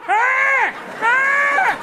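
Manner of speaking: the one-eyed pug voiced as a pirate; pirate growl. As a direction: bark it out like a pirate dog.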